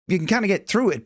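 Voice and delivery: normal talking voice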